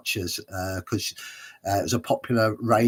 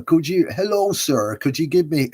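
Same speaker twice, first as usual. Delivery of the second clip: higher, louder mock Somerset accent; attempted Somerset accent; 6 semitones higher, 5 dB louder than usual. Actor in character hailing a taxi, attempted Somerset accent. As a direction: have a rough go at a Somerset accent. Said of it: "I couldn't do his accent".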